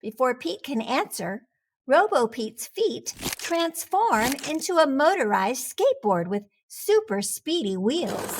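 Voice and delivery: ordinary delivery, nothing marked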